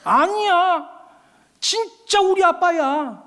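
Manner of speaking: put-on high, slow little boy protesting, near tears; hurt little boy insisting it's his dad; insisting child. Teased child insists tearfully.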